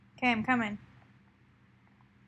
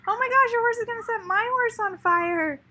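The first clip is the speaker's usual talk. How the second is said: alarmed; 8 semitones higher, 10 dB louder than usual. Real, not acted. Horse catches fire in game.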